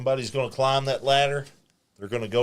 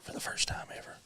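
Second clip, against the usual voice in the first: whispered campfire storyteller; hushed creepy legend telling; 12 dB quieter, faster than usual. Acted. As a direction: breathe it like a spooky legend. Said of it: "Okay, gather around, kids".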